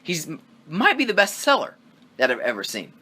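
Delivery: normal talking voice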